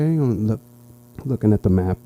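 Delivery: normal talking voice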